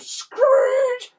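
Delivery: very high, drawn-out squeal; screeching tyres. A narrator's reading. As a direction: screech the word like skidding tyres. Narrator imitates skidding van.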